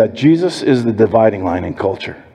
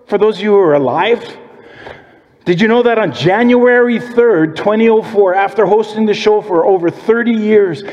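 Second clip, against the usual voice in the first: rising preacher build; 8 semitones higher than usual. Acted. Builds the sermon to full preaching.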